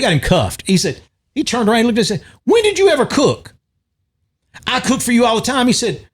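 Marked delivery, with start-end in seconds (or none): 2.4-3.5 high mock outraged question; incredulous man. Retold Cops arrest argument. 4.7-5.7 mock woman's voice. Retold Cops arrest argument.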